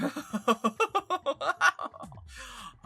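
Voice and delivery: high, loud crazed laughter; manic laugh. Shown as a demonstration. Demonstrating a game character's craziness, manic laugh. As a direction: let a long manic laugh climb out. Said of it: "with that manic kind of craziness on top of it".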